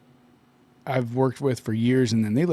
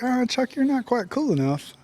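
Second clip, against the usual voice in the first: high-pitched voice for a talking goatee; 8 semitones higher than usual. Acted. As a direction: play a picky goatee, pitched well up. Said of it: "Chuck Norris's goatee was like".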